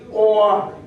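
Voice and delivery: normal talking voice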